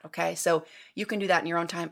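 usual speaking voice

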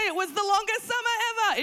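high, exasperated exclamation; exasperated emphasis. Real, not acted. Parent stressing how long summer was, high and exasperated. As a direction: lift it high in mock despair. Echoes her shouted complaint.

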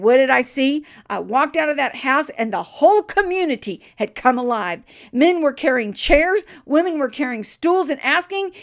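loud, high, soaring sermon delivery; preaching at full force. Real, not acted. Preacher tells how revival broke out, full-force proclaiming. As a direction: proclaim it with mounting fervour.

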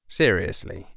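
ordinary delivery, nothing marked

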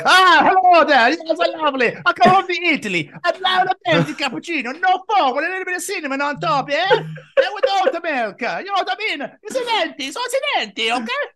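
put-on camp Italian accent ordering coffee; over-the-top Italian coffee order; mock Italian accent. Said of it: "why have you got the Italian accent on".

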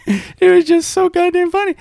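real laughter breaking through the words; high, laughing voice, slowed by giggles. Real, not acted. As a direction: talk while cracking up. Cracking up at the story.

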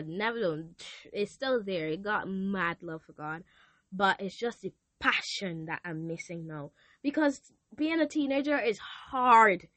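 ordinary talk, nothing marked

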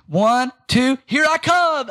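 put-on high, loud little-girl counting; imitating a child. Pastor imitating his four-year-old daughter counting, high and loud. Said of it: "Lillian counts like this".

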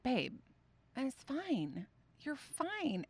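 softly, lightly voiced mom soothing; soft reassurance. Mom soothing her daughter, soft and hushed. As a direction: gently brush it off. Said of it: "I think I said it exactly like that".

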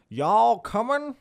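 high, loud, slow Southern call; Southern accent. Shown as a demonstration. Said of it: "I tend to speak in that kind of voice".

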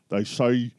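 soft, low Connery line in a light Scottish burr; understated Scottish Connery wisdom; Sean Connery impression. Quote quiz for his co-host, a soft light Scottish Connery impression. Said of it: "this is a little known movie".

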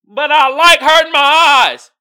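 loud, high, hammed-up protest; mock defiant whine. Kid answering grandma about the TV, mock loud protest. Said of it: "You like".

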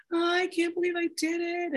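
high, hammed-up dismay; over-emotional self. Overeater mocking her old self, high melodramatic dismay. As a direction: play it as melodramatic regret. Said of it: "all full of that over emotional thing".

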